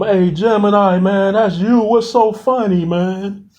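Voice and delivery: high, uneasy call into the dark; nervous calling out. Acted. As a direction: call out nervously to a friend you can't see.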